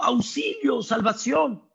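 high, shouted plea for rescue; the people shouting to God for rescue; mock cry for help. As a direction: yell it like someone about to drown. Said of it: "tipo gritando".

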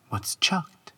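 usual speaking voice

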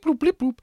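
high electronic beeping noises; robot beeps. Retold or imitated. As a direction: chirp like a droid computer. Imitating ship navigation beeps.

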